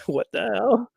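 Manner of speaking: high laughing what the hell; laughing disbelief. Retold or imitated. Vlogger laughing at her own reaction to the match. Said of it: "I was like".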